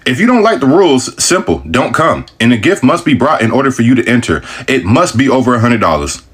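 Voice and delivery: usual speaking voice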